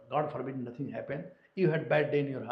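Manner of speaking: ordinary talk, nothing marked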